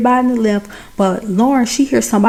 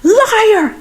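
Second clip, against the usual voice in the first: much higher, shrill mock scream; screaming liar; 11 semitones higher than usual. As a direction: scream it as the crazed stalker. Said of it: "he screams at that lady like".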